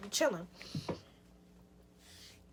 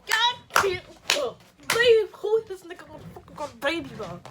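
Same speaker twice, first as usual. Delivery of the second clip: loud, higher disbelief; shocked outburst; 4 semitones higher, 5 dB louder than usual. Retold or imitated. Storyteller re-enacting shock at boyfriend's baby news, loud disbelief. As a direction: burst out in re-enacted outrage.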